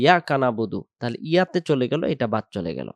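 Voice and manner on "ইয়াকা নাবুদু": "ইয়াকা নাবুদু" spoken plainly as yaka nabudu; common mistaken reading. Shown as a demonstration. Teacher imitating learners' mistake, "ইয়াকা নাবুদু" as short yaka nabudu. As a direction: drop the opening i and the ain in "ইয়াকা নাবুদু". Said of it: "আর যদি বলি".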